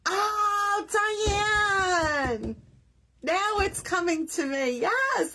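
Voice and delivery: high-pitched delighted outburst; drawn-out high cry as it dawns on you; excited recognition. Real, not acted. Greeting Diane as the name comes back, excited.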